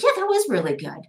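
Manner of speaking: high, bright surprised gush; amazed delight. Retold or imitated. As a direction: pitch way up, thrilled at her own work. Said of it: "And I'm like".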